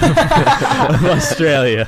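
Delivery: drawn-out words through real laughter; laughing. Real, not acted. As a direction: laugh your way slowly through the guess. Guessing a region, laughing.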